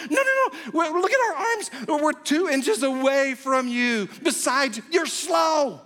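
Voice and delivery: insistent parents. Retold or imitated. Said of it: "they were going".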